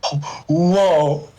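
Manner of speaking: normal talking voice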